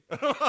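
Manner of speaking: high loud burst of laughter; cracking up high and loud; real delighted laugh. Real, not acted. Laughing at the story.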